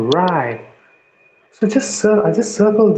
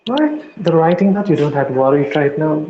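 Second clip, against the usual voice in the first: normal talking voice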